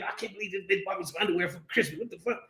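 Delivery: fast, hushed mock grumble; mock kid grumbling. Ungrateful child complaining about a Christmas gift, mock grumble.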